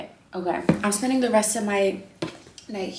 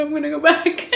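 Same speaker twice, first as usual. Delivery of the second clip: higher, louder laughing retreat; mock nervous retreat; 7 semitones higher, 7 dB louder than usual. Retelling being catcalled, laughing mock nervous voice.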